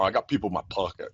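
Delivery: lightly voiced gangster with an odd accent; mock gangster. Co-host jokes about his people in his pocket, mild gangster voice. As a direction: toss it off like a playful gangster. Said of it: "I feel like a gangster when I say that".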